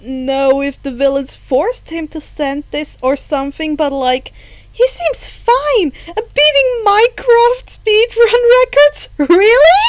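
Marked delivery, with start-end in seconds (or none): 4.7-9.8 put-on high, loud disbelieving girl; incredulous excitement. Hagakure to Shoto about Izuku, high incredulous excitement.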